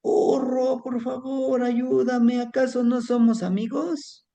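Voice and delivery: high, desperate begging; pleading cry. Acted. Pig about to be slaughtered, begging the donkey, high and desperate. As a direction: beg your friend for rescue, pitched high. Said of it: "Y entonces gritaba".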